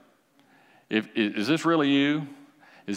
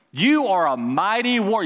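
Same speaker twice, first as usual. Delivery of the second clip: higher and louder, ringing out; proclaimed; 5 semitones higher, 4 dB louder than usual. Shown as a demonstration. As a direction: proclaim it to the room.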